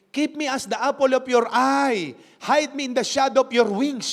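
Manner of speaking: prayer read aloud. Acted. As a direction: proclaim the verse like a heartfelt plea. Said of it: "Sabi ng prayer ni David".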